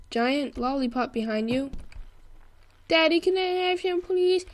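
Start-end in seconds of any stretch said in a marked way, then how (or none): 2.9-4.5 put-on high, pleading kid voice; begging child. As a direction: play a child asking daddy for a treat. Voices child begging father in game.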